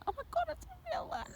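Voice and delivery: high, fast surprise; high fast oh my god; surprised squeal. Real, not acted. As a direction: squeal it in shock. Sheep spotted suddenly.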